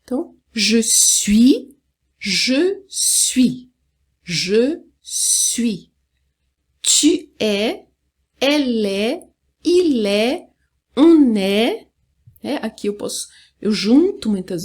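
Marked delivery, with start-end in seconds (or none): 0.5-5.9 je suis spaced word by word, silent final S; French pronunciation model. Shown as a demonstration. Teacher repeating for learners to copy, careful French je suis. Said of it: "agora eu vou repetir mais uma vez". 6.8-11.9 es and est as open "é", endings silent; French pronunciation model. Shown as a demonstration. Teacher drilling the verb être, careful French model. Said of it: "Não pronuncia o S, não pronuncia o T".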